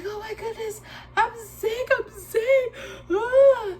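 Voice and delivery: high, wailing fake sob; mock crying. She hams up crying that she is sick. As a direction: whimper I'm sick like a hammed-up cry. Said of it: "realize how cuckoo I am".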